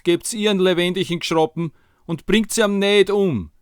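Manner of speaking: high, loud, desperate Viennese plea; pleading mother. Acted. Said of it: "zum König gesagt".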